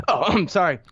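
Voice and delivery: normal talking voice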